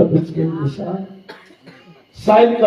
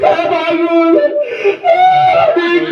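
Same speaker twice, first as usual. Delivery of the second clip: excited calling; 7 semitones higher, much slower than usual. Retold or imitated. Mourners crying out as Niko wakes, mock excited. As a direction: shout as if the corpse is waking. Acted wake from death.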